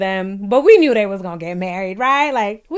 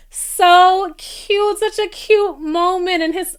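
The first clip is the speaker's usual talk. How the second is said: gushing; 9 semitones higher, slower than usual. Real, not acted. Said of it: "I love that".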